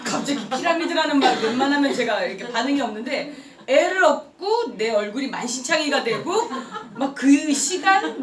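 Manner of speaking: normal talking voice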